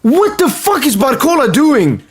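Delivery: can't believe what he's seeing; exasperated outburst. Real, not acted. Fan reacting to Barcola's penalty, high exasperated outburst.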